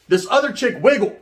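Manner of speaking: ordinary delivery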